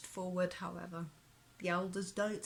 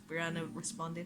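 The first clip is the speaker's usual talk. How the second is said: ordinary delivery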